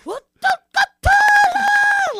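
huge, high, very loud mock sneeze; insane fake sneeze. Class clown disrupting class, elaborate yelled sneeze. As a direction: build into an explosive, yelled sneeze. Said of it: "do an insane sneeze".

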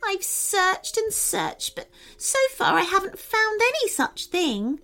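very high, tearful fairy voice; sobbing fairy. A narrator's reading. Fairy who cannot find the feather, tearful. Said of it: "began sobbing again".

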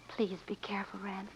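soft, near-whispered concern; hushed worried plea. Acted. Woman pleads with her sweetheart before a risky ride, hushed worry. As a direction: gently beg him to stay safe.

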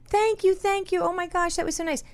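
high, effusive thank-yous; gushing thanks. Retold or imitated. Thanking the old man at the store, high and gushing. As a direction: gush with surprised gratitude. Grateful reply to kind stranger.

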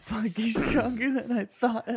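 high, slow, giggly imitation; laughing quote. Co-host repeats a favorite line, laughing and high-pitched. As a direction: squeal the quote through laughter.

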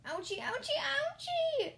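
high, rising whimper of pain; hammed-up squeal of pain. A narrator's reading. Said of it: "I whimpered".

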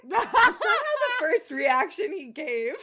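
reading on while cracking up; laughing. Real, not acted. Reader reading on while cracking up at the fic.

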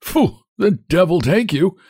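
high, cracked indignant cry; hunchback's outraged outburst; indignantly. A narrator's reading. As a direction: curse the driver in a high, peevish voice. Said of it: "cries the hunchback indignantly".

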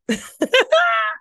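a high, squealing burst of laughter; high shrieking laugh. Real, not acted. Laughs after mock quote.

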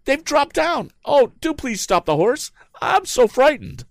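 very high, loud frightened woman's voice. A narrator's reading. The young lady begging Tom to stop the horse, frightened. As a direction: plead with him in panic. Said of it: "she answered frightenedly".

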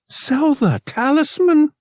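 very high, loud disbelief; incredulous high-pitched outcry; shocked interruption. A narrator's reading. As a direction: break in aghast, stretching the words. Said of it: "she broke in".

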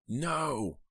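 high-pitched, narrated cry of horror; scream. A narrator's reading. Heather hears Snowman's threat, a restrained scream. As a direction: cry out high, without full volume. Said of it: "hadn't meant to scream so loudly".

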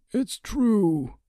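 high, drawn-out agreement; careful older counsellor. A narrator's reading. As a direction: agree with measured care, voice lifted. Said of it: "speaking carefully".

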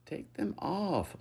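high, drawn-out whine; whining. A narrator's reading. Lucas nagging the narrator, whining. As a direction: whine it slowly. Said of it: "Lucas whined".

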